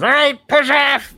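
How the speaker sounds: high, loud, drawn-out gnome order; gnome crew. Acted. Gnome crew launching the ship, high shout. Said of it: "the gnome crew that's on here goes".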